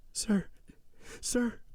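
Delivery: very high, broken woman's voice; frightened pleading. A narrator's reading. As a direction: plead feebly on each word. Accused wife pleads with husband.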